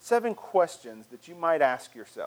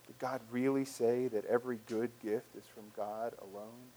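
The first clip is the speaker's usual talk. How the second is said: normal talking voice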